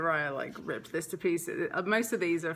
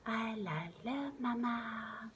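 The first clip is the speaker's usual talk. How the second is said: hushed, slow, higher singsong; imitated toddler's lullaby; 4 semitones higher, 8 dB quieter, slower than usual. Mother recalling her daughter's sweet words, soft toddler singsong. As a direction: coo it softly, drawing out the last word. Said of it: "it was just so sweet to hear".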